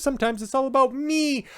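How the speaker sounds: mock diva. Mocking self-centred diva.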